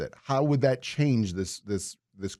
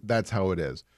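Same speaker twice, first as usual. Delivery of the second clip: ordinary talk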